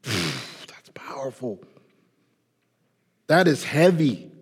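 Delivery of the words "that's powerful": whispered in awe after a sigh; hushed, slow awe; awed whisper. Real, not acted. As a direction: breathe it out, moved.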